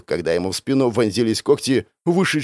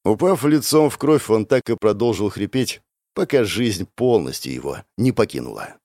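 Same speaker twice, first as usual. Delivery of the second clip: usual speaking voice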